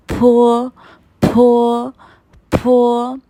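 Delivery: loud फो with a hard puff of air; forceful aspirated फो. Shown as a demonstration. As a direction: drill फो loudly with breath. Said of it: "इसी तरह से आपको pronounce करना है".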